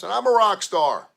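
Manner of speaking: loud, high boast; proud shout. Real, not acted. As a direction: crow it proudly. Boasting to listeners.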